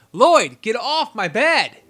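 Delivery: loud, slow telling-off; scolding pet. Real, not acted. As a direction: shoo the dog off the bed.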